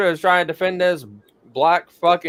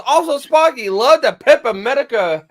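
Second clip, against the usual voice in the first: chat message; 8 semitones higher, 7 dB louder than usual. Retold or imitated. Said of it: "Taco goes".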